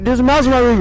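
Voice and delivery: high, loud hammed-up panic shout; mock yelling. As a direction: yell it into the mic in mock alarm. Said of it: "yells into his mic".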